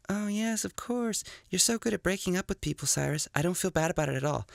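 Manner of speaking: mock her reply in a high voice; voicing Satina. Rehearsing a breakup alone, he plays her answer in a high voice.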